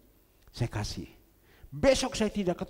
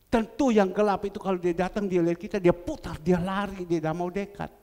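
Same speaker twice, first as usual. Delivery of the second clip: loud preaching; 6 dB louder than usual. Acted. Preaching at full force.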